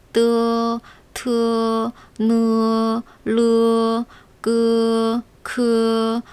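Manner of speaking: 뜨 트 느 르 끄 크 squashed to 으; squeezed syllables. Shown as a demonstration. Teacher showing squashed 으 pronunciation. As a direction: crush each sound flat. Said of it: "찌그러뜨린다는 느낌으로 발음하면".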